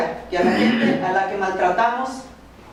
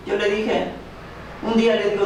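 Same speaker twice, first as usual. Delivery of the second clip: normal talking voice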